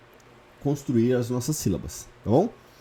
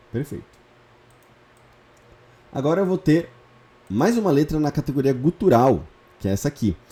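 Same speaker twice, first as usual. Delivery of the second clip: ordinary delivery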